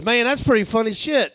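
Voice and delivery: high, loud approving tourist. Retold or imitated. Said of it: "looks at me and goes".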